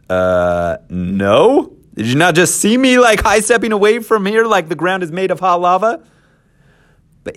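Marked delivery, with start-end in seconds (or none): 0.1-6.0 high, disbelieving whine, rising sharply; incredulous protest. Acted. Moses balking at God's order, incredulous and high. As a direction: push back like you can't believe it.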